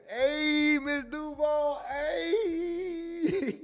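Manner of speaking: high, slow hammed-up sob; mock crying. Streamer fake-sobbing a greeting to Miss Duval. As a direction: wail her name like a fake crier.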